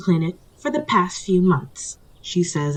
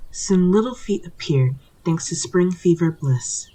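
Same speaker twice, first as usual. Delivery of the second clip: ordinary talk, nothing marked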